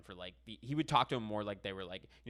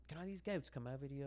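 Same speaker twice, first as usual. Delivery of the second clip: lightly put-on posh British voice; imitating Gordon asking a favour; mock British Gordon; 5 semitones higher, 6 dB quieter than usual. Gordon asking David Beckham a favour, mock British. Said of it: "was like".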